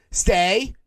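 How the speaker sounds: rising high stay, stay, stay as to a dog; commanding like a dog. Retold or imitated. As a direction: order him to stay like a pet. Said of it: "points at him and goes".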